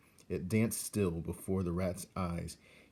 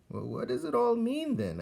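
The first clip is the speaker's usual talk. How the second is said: put-on much higher, puzzled young rat; Roscuro the rat; 3 dB louder than usual. Young rat asks old rat what life means, puzzled. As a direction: ask it like a baffled youngster.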